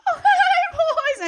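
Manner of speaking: high squealing flirty hello; flirty moms.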